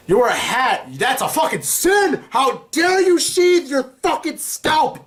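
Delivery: high, drawn-out cry of righteous horror; mock outraged scolding. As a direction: thunder it like a scandalised zealot, high-pitched. Mock scolding over a religious rule.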